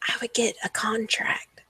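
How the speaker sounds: soft conspiratorial whisper to a friend; hushed aside, barely voiced. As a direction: whisper it as private advice. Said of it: "I kinda whisper to Lucy".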